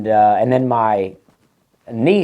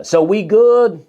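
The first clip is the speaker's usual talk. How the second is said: high, slow, louder casual check-in; mock server; 8 semitones higher, 4 dB louder, slower than usual. The server girl asking the family if they're done, high slow drawl.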